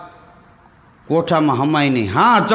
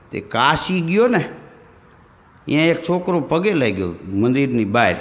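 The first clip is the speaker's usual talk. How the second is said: normal talking voice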